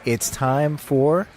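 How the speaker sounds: slow, drawn-out game show build-up; drawn-out announcement. Acted. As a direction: stretch it like a host intro. Announcing the split test segment.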